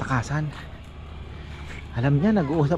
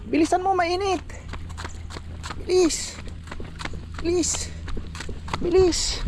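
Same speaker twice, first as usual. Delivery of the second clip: calling out; slower than usual. Real, not acted. Urging child to hurry.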